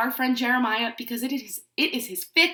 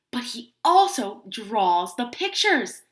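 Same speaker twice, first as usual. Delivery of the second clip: ordinary delivery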